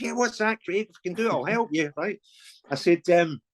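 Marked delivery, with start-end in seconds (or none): 0.0-1.9 high-pitched mimicked phone reply. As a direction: put on an obliging caller, pitched up. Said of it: "That was a great impression as well".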